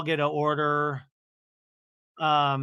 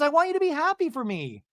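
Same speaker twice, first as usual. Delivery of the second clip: high-pitched mock plea; imitated high pleading; 10 semitones higher than usual. Brittany wants her friends happy, high imitated pleading.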